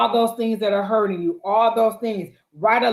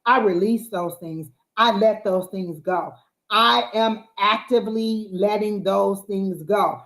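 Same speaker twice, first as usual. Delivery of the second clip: firm affirmation; slower than usual. Shown as a demonstration. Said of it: "Not "I want to".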